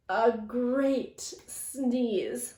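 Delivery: drawn out slowly. Acted. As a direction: stretch each word out. Drawn out after sneeze.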